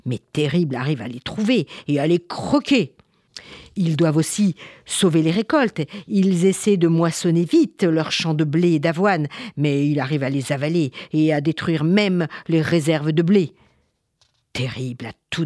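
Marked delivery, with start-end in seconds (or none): none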